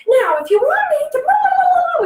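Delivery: high, loud mock lecture; lecturing grown-up. Teacher mocking her own lecture to the kids, high and loud. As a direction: ham up the droning scold. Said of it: "you think".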